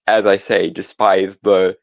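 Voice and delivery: normal talking voice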